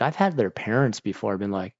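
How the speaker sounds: usual speaking voice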